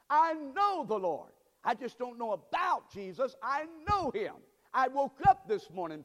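high, loud pulpit testimony; fervent preaching. Real, not acted. Preacher testifies that he knows the Lord, high and forceful.

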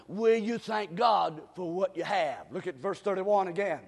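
usual speaking voice

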